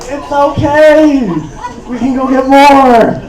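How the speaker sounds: comforting an upset pregnant ex with a touch of laughter; mock soothing. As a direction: coax her like a child, laughing. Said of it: "I'm like".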